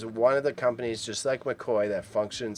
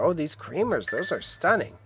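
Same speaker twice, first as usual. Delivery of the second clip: ordinary delivery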